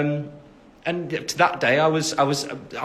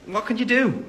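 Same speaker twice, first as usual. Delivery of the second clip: higher mock-helpless "what can you do?"; mock helpless shrug; 10 semitones higher than usual. Real, not acted. Shrugging off staying out too long, high mock resignation.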